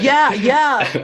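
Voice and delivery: high, loud mock moans of pleasure; imitating a high, loud ecstatic yeah. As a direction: ham up a tripping guy's ecstasy. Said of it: "And he's like".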